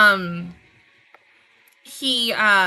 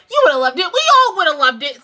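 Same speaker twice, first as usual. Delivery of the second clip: 1 emphatic; 6 semitones higher, 9 dB louder, faster than usual. Real, not acted. Emphatic wishful build-up.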